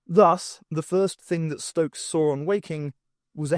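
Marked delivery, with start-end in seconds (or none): none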